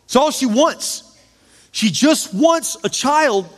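anguished plea rising to a high, drawn-out shout; angry yelling. Retold or imitated. Grieving husband yells at God over the lost baby, angry yelling. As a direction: build from hurt to crying out at God, re-enacted. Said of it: "I just yelled at God. And I was so mad".

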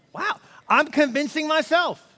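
high, loud and slow, amazed at himself; playful self-surprise with a grin; mock amazement. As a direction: marvel at your own words, hammed up.

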